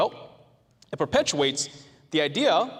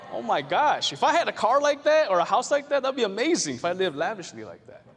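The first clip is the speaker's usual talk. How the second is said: much higher and louder dreamy gush; mock gushing; 7 semitones higher, 5 dB louder than usual. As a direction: swoon over the lavish life. Gushing about Crazy Rich Asians.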